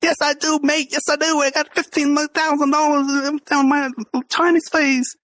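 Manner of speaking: high-pitched mock Australian accent; put-on Aussie accent, pitched well up. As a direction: imitate an indignant Aussie with a hammed-up accent. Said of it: "Oh, Australian accent".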